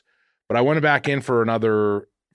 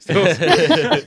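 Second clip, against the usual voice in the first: slow drawn-out quote; 8 semitones higher, 5 dB louder, much slower than usual. Retold or imitated. Quoting the Gimp joke.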